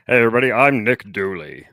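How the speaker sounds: loud, badly faked host greeting; Nick Dooley. Host greeting everybody, loud botched impression. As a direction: mimic a podcaster, projecting it loudly. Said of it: "You sound like my daughter".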